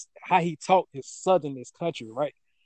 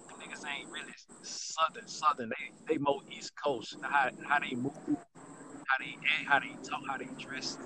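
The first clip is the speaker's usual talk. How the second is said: normal talking voice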